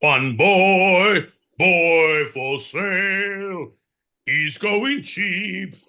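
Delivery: high, loud, slow street hawker; market crier. Acted. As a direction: cry the wares across a Victorian market. Dickensian market crier selling boy.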